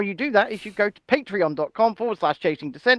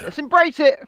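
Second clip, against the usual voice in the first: rallying cry; 6 semitones higher, 6 dB louder than usual. Real, not acted. Rallying about being called grifters.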